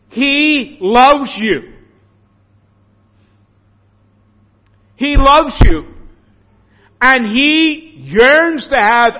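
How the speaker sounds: loud, high, drawn-out proclamation; emphatic preaching. Real, not acted. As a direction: preach it with full force, stretching key words. Preacher presses home God's love.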